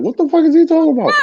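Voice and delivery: baffled outburst. Retold or imitated. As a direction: rattle it off in disbelief. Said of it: "like I said".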